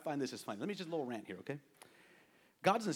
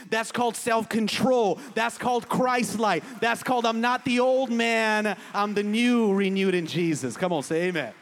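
loud, emphatic pulpit declaration; full-force preaching; 5 semitones higher, 10 dB louder than usual. Real, not acted. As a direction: proclaim it in ringing, parallel phrases.

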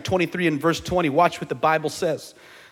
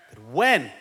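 "when" punched with a pitch swoop; "when" set apart and emphasized; stress on when; 4 semitones lower than usual. Real, not acted. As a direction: hit the word "when" hard. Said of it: "emphasis on when".

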